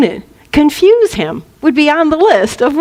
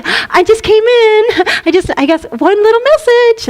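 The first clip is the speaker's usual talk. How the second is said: hammed-up high mother caricature; obnoxious mother; 9 semitones higher than usual. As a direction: wheedle your way in as a pushy mother. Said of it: "a typical mother of, of the obnoxious kind".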